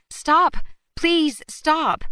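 high, loud cry to stop the car; desperate shout. Acted. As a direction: yell it out to halt a speeding car. Flags down car in road.

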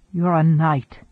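plain reading voice

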